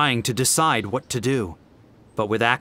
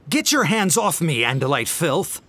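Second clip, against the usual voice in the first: furious scream, a bit higher; 5 semitones higher, 3 dB louder than usual. A narrator's reading. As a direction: spit it out in rage at the alien. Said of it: "he screamed aloud".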